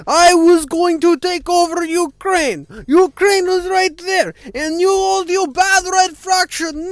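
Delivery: high, loud, slow hammed-up Russian accent; terrible Russian accent. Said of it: "Terrible Russian accent".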